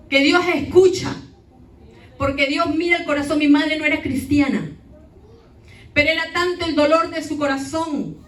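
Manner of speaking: fervent pulpit delivery; preaching. Acted. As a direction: press the point home to the church. Preaches to the church.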